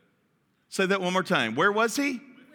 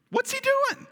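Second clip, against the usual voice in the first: much higher, in disbelief; incredulous. Real, not acted. Preacher asking the congregation why Adam stood by, incredulous and high. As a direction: ask it in high, stunned disbelief. Exasperated question about Adam.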